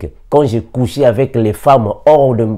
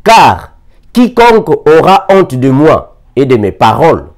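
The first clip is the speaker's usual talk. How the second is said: raised, louder proclamation of the verse; scripture reading; 4 semitones higher, 4 dB louder than usual. Acted. Preacher reading Luke to the church, raised voice. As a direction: proclaim the words of Jesus. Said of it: "Il dit ainsi".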